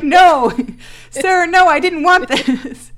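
high, loud refusal cracking into laughter; mock-horrified protest. Viewer refusing what the actor offers, mock-horrified protest. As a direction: protest in hammed-up horror, slowing at the end.

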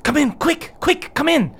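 high, hurried whisper-shout to come in; urgent dwarf girl. Acted. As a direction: hurry them inside, high voice. NPC urges them inside.

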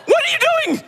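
extremely high, squealed mock panic; horrified outburst. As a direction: squeal it, aghast at your own mouth. Aghast at his own mouth.

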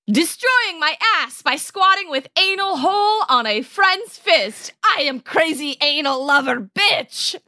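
high, over-the-top proclamation; hammed-up yelled read. Co-host reading out their last one, hammed-up and high. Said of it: "has a very similar tone".

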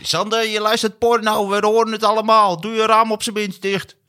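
high mocking neighbour voice; imitation voice. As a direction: do a high, nagging impression. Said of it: "dat is niet slecht, ... het is lager".

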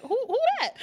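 very high, incredulous stammered question; retold bafflement. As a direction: replay your confusion in a high squeal. Retells own confused reaction.

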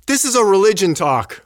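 loud, high-pitched mock outburst; angry retold doctor. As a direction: imitate an indignant heckler calling out. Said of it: "He was, like, angry".

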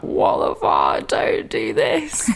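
low, slow husband voice; a deep drawn-out cry from the husband chasing her; angry husband. Retold or imitated.

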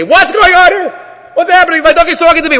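high, loud, mock panic; astonished outburst. Imagined Bilam reacting to his talking donkey, astonished. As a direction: exclaim in alarmed disbelief, pitch way up.

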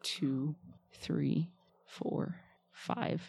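whispered counting; counting under breath. Real, not acted. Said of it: "I'm counting".

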